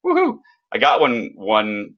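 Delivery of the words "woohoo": gleeful whoop. Retold or imitated. Author cheering a royalty deposit, a high gleeful whoop.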